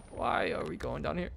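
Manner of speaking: small, resigned nervous question; subdued dread. Real, not acted. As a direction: ask it under your breath, uneasily. Repeats the question more quietly.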